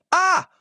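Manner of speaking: sudden high yelp of fright; startled scream. Acted. Mock shriek at a ghost popping out of a cookie.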